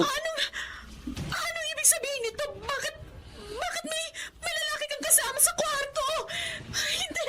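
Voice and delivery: choked, teary disbelief; tearful shock. Acted. Wife catching husband with a man, tearful shock. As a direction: voice breaking as she confronts him.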